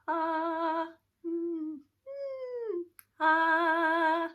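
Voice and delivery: loud held "ah" and high hummed "mm"; twang exercise. Shown as a demonstration. Teacher demonstrating how to tilt into twang, high twang vocalise. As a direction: alternate bright twanged "ah" with high whined "mm". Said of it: "to sing in a twang style".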